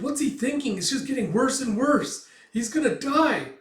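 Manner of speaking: high-pitched anxious cry; panicked fretting. Retold or imitated. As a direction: wail in worry for a friend. Acts Puck fretting over Guts.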